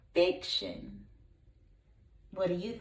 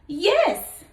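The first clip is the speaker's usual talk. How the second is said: big brother goat; 8 semitones higher, 5 dB louder than usual. Acted. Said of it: "said his big brother".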